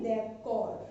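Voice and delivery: d'accord said dah-kor, the final d silent; teacher modelling d'accord without the final d. Shown as a demonstration. Teacher showing students that d'accord's last d is silent. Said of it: "silent रहेगा".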